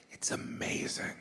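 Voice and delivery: unvoiced confiding whisper. Real, not acted. Confessing the joys of showbiz, in a whisper. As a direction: whisper it like a guilty secret.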